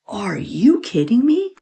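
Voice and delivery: slow breathy disbelief; incredulous mock whisper. Retelling the cleaning lady's outrage, slow mock whisper. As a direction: hiss it out in mock shock. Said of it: "she goes".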